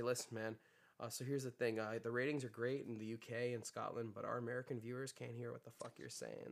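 a hint of a pushy producer, hushed; producer impression.

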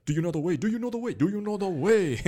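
put-on mobbing chant in a Ugandan accent. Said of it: "be like".

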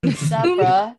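from posh greeting to loud surfer bro; prim to bro switch. Shown as a demonstration. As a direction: start prim and proper, drop into a bro greeting. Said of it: "I talk like this... and go".

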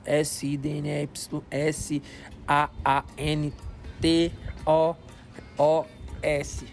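slow, letter by letter; spelled out slowly. Shown as a demonstration. Spelling out Instagram handle. (soletrando devagar)

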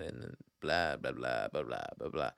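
mocking gossip rundown; bored blah blah. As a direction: brush it off as boring chatter. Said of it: "just talking about, like".

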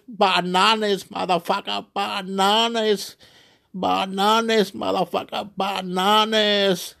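high, drawn-out chant; mock going bananas. Brain seeing Chile on telly, mock crazed chant. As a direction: stretch each "bananas" out high and slow.

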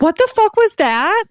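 high, stretched-out freaked-out demand; alarmed. Retold or imitated. Sister's startled reaction acted out.

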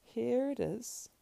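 high, slow, hesitant handing over; rather startled. Acted. Sam yields the Ring to Frodo, rather startled. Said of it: "rather startled".

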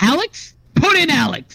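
real voice crack, jumping high; voice cracking up an octave. Real, not acted. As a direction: voice breaks upward mid-shout. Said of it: "Oh, my voice cracked".

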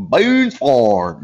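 thick mock drawl, accent swallowing the words; garbled drawl impression. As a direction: mimic her barely intelligible drawled accent. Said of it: "understand what the fuck this lady was saying".

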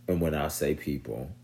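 usual speaking voice

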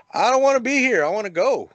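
high, loud plea to get out; half-joking panicked whine; scared whining. Retold or imitated. Scared hiker begging his friends to leave, high and loud. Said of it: "I was like".

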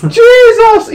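high, loud surprised cry; shocked exclamation. Real, not acted. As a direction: shout it out in disbelief. Reacting to crude joke.

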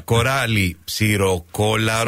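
slow, careful tongue twister; slowly. Shown as a demonstration. Guest tries a hard tongue twister first, slowly. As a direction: take it word by word, slowly. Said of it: "να το πω μια αργά".

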